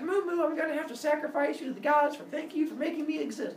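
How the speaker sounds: voiced baby. Baby talks to doomed cow.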